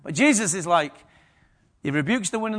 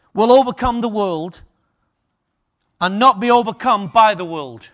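preaching; 3 semitones higher, 10 dB louder than usual. Acted. Continued preaching, louder.

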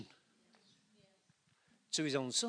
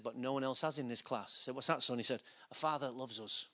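hushed retold confession; 5 semitones lower, 12 dB quieter than usual. Son's tender confession retold.

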